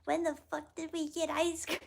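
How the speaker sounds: very high put-on movie voice; The Ringer impression. Streamer quoting The Ringer to chat, high character voice. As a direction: quote it in a squeaky-high character voice. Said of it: "that's from The Ringer".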